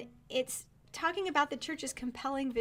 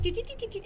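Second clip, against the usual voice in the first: high sing-song dee-dee-dee hum; humming while tapping; 10 semitones higher, much faster than usual. Retold or imitated. A friend imitating Sarah buying the domain, high sing-song hum. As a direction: hum in a higher pitch like someone busily tapping a phone. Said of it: "she pulls out her iPhone and she's like".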